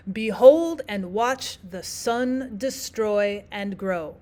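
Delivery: slow weak-strong beat: beHOLD and WATCH the SUN deSTROY and GROW; measured iambs, each second syllable of Behold, and watch, the sun, destroy, and grow stressed; iambic stress. Shown as a demonstration. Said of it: "unstressed syllable at the very beginning".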